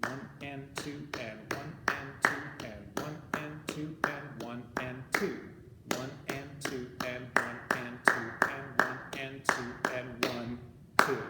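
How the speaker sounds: slow, steady rhythmic count; counting out loud. Shown as a demonstration. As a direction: count each beat evenly, in time with claps. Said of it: "counting out loud".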